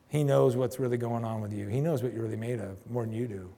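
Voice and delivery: normal talking voice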